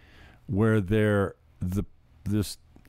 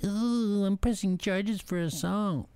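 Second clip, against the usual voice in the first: high, prissy mock voice, much higher; mock whiny complainer; 11 semitones higher, slower than usual. Mocking a whiny man who presses charges. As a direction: sneer at the guy who runs to the cops. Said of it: "I'm not a guy that goes".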